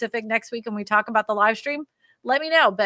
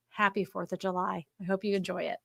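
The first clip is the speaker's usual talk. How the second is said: normal talking voice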